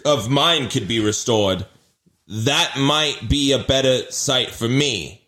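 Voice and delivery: ordinary talk, nothing marked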